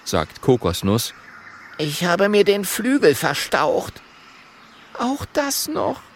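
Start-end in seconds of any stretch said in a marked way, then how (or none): 1.8-4.0 high, small dragon voice; high-pitched young dragon; little dragon. Acted. 5.0-6.0 high, slow wail of dismay; high-pitched, drawn-out despair. A narrator's reading. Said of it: "sagt Mathilda verzweifelt".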